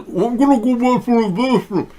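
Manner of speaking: high falsetto imitation of her excuse; mock woman's voice. Host mimicking the woman leaving, high falsetto. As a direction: squeak it up like the lady. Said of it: "you'll notice she said".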